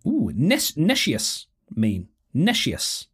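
ordinary talk

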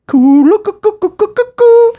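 very high, loud cooing call; imitated bird call. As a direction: coo like a wild bird in a nature film. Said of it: "they play some sort of".

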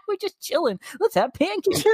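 high sarcastic sibling impression; mock casual sister. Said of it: "And it's like".

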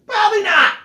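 high mocking squeak; mock the gun owner, squeaky. Mocking the gun owner with a high squeaky voice.

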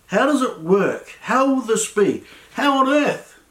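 high-pitched, insistent baffled questioning; acted bewilderment. Preacher voices your thoughts in a hard situation, baffled and pleading. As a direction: pile up the questions in mock perplexity.

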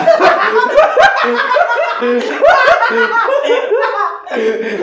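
long, very high burst of laughter. Real, not acted. Joking about the wand, long high laugh. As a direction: cackle at your own joke. Friend cracks up at the joke.

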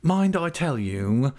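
slow, high-pitched warning; schemer's insistent caution. A narrator's reading. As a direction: draw out the threat. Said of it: "continuing to warn me".

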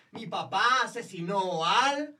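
high, loud, slow sentence left hanging; show-host cue. Pretend. Host prompting all to say the word together, sing-song call. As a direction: draw it out for the audience to finish. Host cues group chorus answer.

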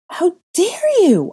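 high, very loud, drawn-out roar; furious high shout; outraged roar. A narrator's reading. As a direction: roar it at the bullies, stretching the words. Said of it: "I roared".

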